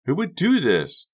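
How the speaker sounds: high, slow and loud; Carrie appalled. Acted. Carrie looks at the locker, slow high appalled. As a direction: ask it in slow disbelief, high.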